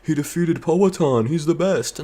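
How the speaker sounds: high mocking fanboy gush. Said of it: "They're like".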